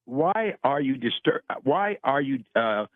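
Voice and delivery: ordinary talk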